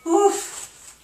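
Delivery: effort grunt. Real, not acted. Straining through a workout move.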